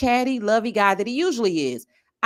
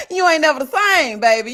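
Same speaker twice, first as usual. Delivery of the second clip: louder punchline, ending on a fall at same; sassy shout; 4 semitones higher, 6 dB louder than usual. Pretend. Vlogger shouts the punchline back at viewers, loud and sassy. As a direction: belt it out with sass.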